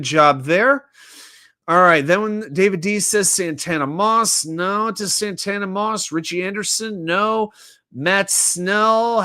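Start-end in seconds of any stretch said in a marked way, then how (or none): none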